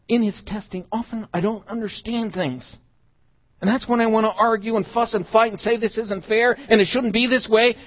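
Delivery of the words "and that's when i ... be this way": loud, high-pitched complaining; mock tantrum of indignant protest; fussing protest. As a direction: argue it out in a raised, worked-up voice. Acts out arguing and fussing with God.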